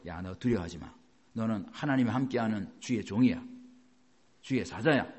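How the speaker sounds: put-on low, soft reassurance to a young pastor; steady him gently like an old mentor; fatherly encouragement. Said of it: "바울이 뭐라 그래요". (바울이 디모데를 다독이는 낮고 부드러운 말투)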